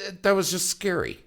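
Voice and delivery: high, stammering mock fright; imitated unease. Grown son admitting to Dad he was scared, stammering. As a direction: stumble into a sheepish admission. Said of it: "And he goes".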